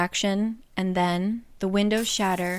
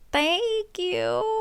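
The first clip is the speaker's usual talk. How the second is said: high, strained polite thanks; retold Feyre thanking him for a gift she hates; forced thanks; 11 semitones higher than usual. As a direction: fake the gratitude.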